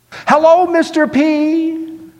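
put-on very high, loud child's voice; high-pitched little-girl holler; little girl calling. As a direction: sing out a drawn-out greeting like a small child. Child calls out to narrator.

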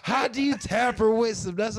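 high, loud, drawn-out disbelief; incredulous retelling. Retold or imitated. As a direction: protest loudly in a high voice. Retells accusation, indignant.

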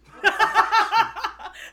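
loud high burst of laughter. Real, not acted. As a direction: crack up loudly.

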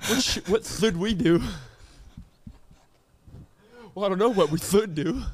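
"should" said as "thood", SH turned to TH; teasing lisp on "should"; mock lisp. Friend teases the lisper's SH, mock-lisping.

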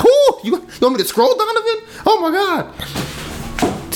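very high mock falsetto whine; mock your girlfriend's complaining in falsetto; whiny mocking imitation. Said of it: "That's how you sound".

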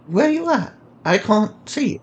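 high, lightly voiced young girl; a hint of a curious kid; girl's voice. Samila voiced in dialogue.

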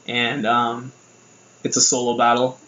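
usual speaking voice

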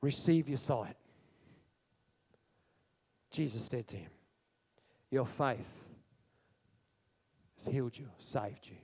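soft, low, tender; gentle healing words. Acted. Jesus healing the blind man, soft and low. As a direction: speak it quietly, like a blessing. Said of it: "Jesus speaks healing".

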